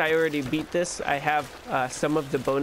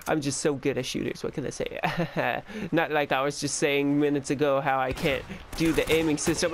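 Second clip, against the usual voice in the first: ordinary talk, nothing marked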